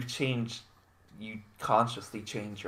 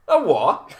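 baffled; 7 semitones higher, 10 dB louder than usual. Retold or imitated. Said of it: "they were like".

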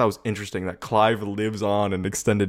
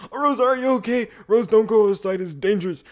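much higher, louder mock-worried mother; panicky mom; 12 semitones higher, 5 dB louder, faster than usual. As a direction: squeal it like a fretting mum. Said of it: "her mom's just like".

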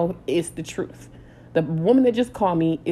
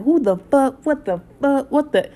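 higher, louder furious cursing; going off; 7 semitones higher, 4 dB louder than usual. Retold or imitated. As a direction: let the rage spill out. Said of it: "I'm going off".

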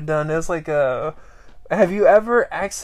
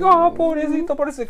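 pitying coo; 11 semitones higher than usual. Retold or imitated. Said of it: "I'm like".

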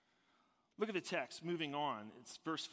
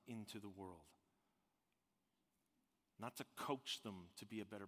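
soft, lowered voice with weighty pauses; hushed, reverent preaching; 4 semitones lower, 8 dB quieter than usual. Real, not acted. Preacher telling the congregation Jesus came to save sinners, hushed and reverent. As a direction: bring it right down, solemn and low.

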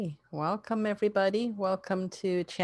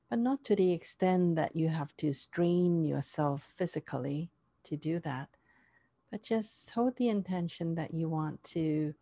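guided meditation; 3 dB quieter than usual. Acted.

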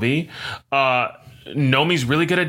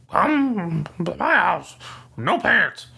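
high, drawn-out cartoon voice; Scrooge McDuck; 6 semitones higher, much slower than usual. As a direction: put on a slow, high cartoon duck voice. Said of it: "if Scrooge McDuck was like".

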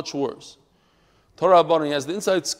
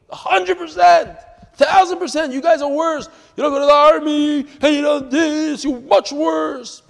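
heated shout; 10 semitones higher, 8 dB louder than usual. Retold or imitated. Retold heated argument with woman.

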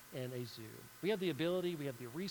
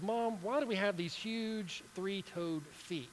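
put-on higher, slow curious kid voice; young camel voice; 5 semitones higher, slower than usual. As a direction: ask like a wide-eyed little kid. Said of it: "this young camel comes up to his mother and says".

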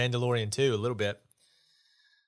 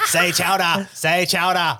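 put-on Boston accent; 7 semitones higher, 7 dB louder, slower than usual. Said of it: "Boston accent".

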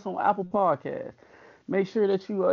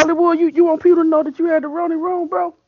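outraged; 10 semitones higher, 6 dB louder, faster than usual. Real, not acted. Teasing outrage at friend.

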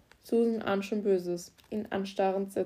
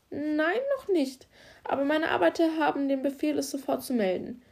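much higher, louder, flustered little man; nervous garage boss; 7 semitones higher, 3 dB louder than usual. Acted. Garage boss to the officer, high and flustered. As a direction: stammer out an eager answer.

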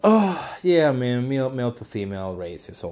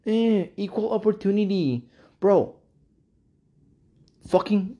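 mock whiner; 11 semitones higher than usual. Mocking whiny equal-opportunity advocate.

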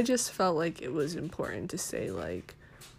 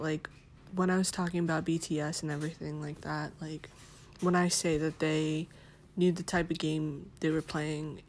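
ordinary delivery

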